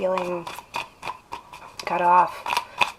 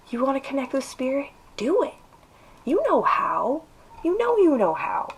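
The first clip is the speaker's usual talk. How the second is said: yelling encouragement; 5 semitones higher than usual. Real, not acted. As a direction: urge them on, voice climbing high. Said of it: "Now I'm yelling at you again".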